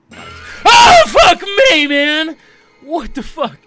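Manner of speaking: screaming in sudden fright; terrified scream. Real, not acted. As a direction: shriek at the jump scare. Jump scare in horror game.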